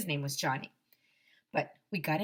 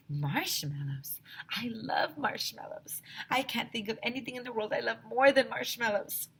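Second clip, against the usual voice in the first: dreamy whisper; 5 semitones higher than usual. Acted. Teacher swooning over marshmallows.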